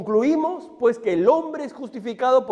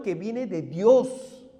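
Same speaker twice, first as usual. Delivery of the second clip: slow emphasis; 2 semitones lower, much slower than usual. Real, not acted. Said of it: "slowed for emphasis".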